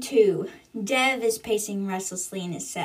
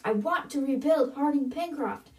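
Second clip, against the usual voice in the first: higher-pitched mock yell at normal volume; held-back shout, pitch raised; mock scream; 5 semitones higher than usual. A narrator's reading. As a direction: scream it in imitation, without real volume. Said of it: "screams from the top of her lungs".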